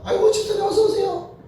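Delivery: high-pitched mock-gushing greeting; fawning welcome. Church folk greeting the rich deacon, fawning welcome. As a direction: imitate someone fussing over an honoured guest.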